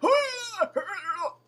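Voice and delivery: high, loud laughing burst; real laugh breaking out mid-rant; burst of laughter. Real, not acted. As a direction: laugh out at the silliness. Laughing at her own mockery.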